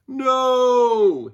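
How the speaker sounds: high loud panicked NO; man's shout. A narrator's reading. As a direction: cry out as you fall. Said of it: "he shouted".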